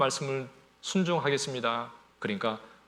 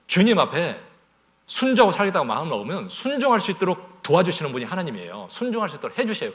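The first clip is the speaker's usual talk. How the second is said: loud, higher urgent exhortation; fervent preaching; 4 semitones higher, 6 dB louder than usual. Acted. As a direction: press the congregation hard. Preacher urging obedience.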